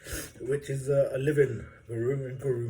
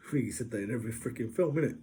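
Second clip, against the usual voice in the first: ordinary delivery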